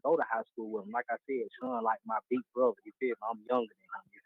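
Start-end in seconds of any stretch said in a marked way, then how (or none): none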